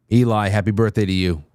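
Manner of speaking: ordinary delivery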